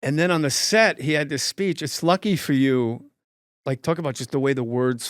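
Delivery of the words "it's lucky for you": slow, lightly voiced Walken cadence; Walken impression. Lightly voiced Walken reciting his speech. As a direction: let the words fall like a song. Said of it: "it's like a song".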